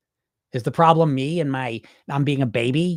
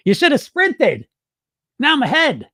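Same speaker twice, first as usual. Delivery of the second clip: higher, louder gloating brag; cocky sprinter; 9 semitones higher, 4 dB louder than usual. Retold or imitated. Sprinting dieter taunting the pacing runners, higher and louder gloating. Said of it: "You're like".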